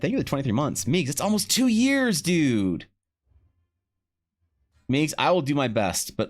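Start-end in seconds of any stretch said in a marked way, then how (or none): 0.0-2.9 higher, swooping excited thanks; hyped shout-out. Real, not acted. Streamer thanks Meegs for almost two years, hyped and swooping. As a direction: greet them with rising, delighted amazement. Streamer hails a long-time subscriber.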